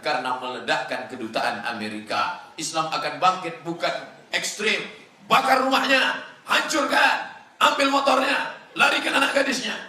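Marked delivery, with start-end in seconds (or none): 5.3-9.8 high, loud barked orders, faster at the end; mock rampage shout. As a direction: shout it like a mob egging itself on. Acting out violent extremists.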